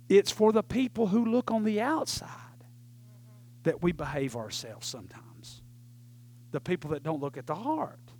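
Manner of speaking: soft, low near-whisper to the congregation; quiet, weighty aside from the pulpit; hushed explanation. Real, not acted. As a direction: drop right down and confide it. Preacher drops to a hush.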